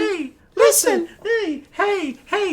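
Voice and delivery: very high squeaky fairy nagging; Navi voice. Retold or imitated. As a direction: pester in a tiny fairy squeal.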